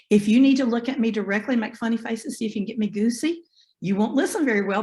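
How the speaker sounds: ordinary delivery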